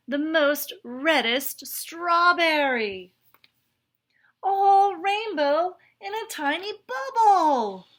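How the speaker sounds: put-on childlike awe; excited wonder. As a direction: gush about the day's marvels. Straw excitedly recounts his day.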